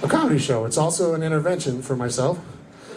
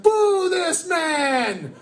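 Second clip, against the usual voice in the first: mock boo; 12 semitones higher, much slower than usual. Greeley crowd booing joke.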